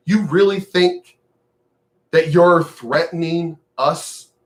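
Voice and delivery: normal talking voice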